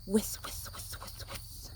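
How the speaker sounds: whispered, fading whis sounds; sprinkler whisper. Shown as a demonstration. Showing children a sprinkler, fading whispered whis. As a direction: breathe out a soft turning sprinkler hiss. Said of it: "They go like this".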